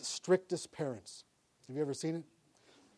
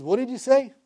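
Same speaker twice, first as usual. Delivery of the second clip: high, louder astonished question; acted startled question; 8 semitones higher, 6 dB louder than usual. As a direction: play God pricking up at a repenting heart. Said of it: "he says".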